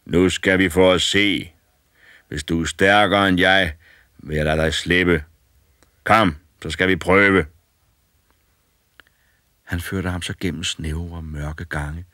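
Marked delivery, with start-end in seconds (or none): 0.1-7.4 loom over him and promise death; menacing old giant. A narrator's reading. Old man challenge.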